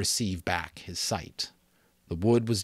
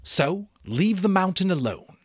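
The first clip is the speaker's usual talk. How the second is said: higher, louder solemn decree; solemn chief; 12 semitones higher, 6 dB louder than usual. Acted. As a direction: pronounce it like a sacred law. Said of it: "said the chief solemnly".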